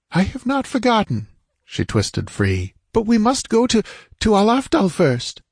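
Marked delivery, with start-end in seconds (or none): 0.1-1.3 high-pitched female retort; indignant woman. Acted. Woman retorts, twisting free. 2.9-5.4 high, urgent female character voice; insist, with a small stumble of urgency; insistent woman. Acted. Woman insists on going first.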